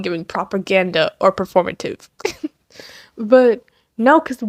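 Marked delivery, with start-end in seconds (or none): none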